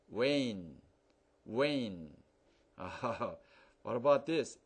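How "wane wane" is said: "wane" with a long A like the letter name. Shown as a demonstration. Teacher reading the word with the E added. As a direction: stretch the A in "wane". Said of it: "Now, E there".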